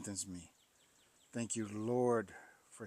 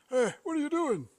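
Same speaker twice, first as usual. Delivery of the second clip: higher, louder gruff worker calling out; worker's challenge; 13 semitones higher, 5 dB louder than usual. Retold or imitated. Landfill worker calls out to him, mock challenge. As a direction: bark it like the landfill guy catching him.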